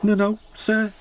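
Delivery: high, nervous stammering reply. A narrator's reading. Oliver answering the Fat Controller, nervous. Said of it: "said Oliver nervously".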